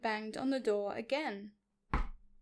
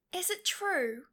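higher, lightly voiced princess; princess flattering; 6 semitones higher than usual. A narrator's reading. Elizabeth flattering the dragon, coaxing. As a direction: coax with a leading question.

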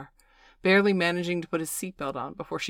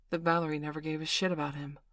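usual speaking voice

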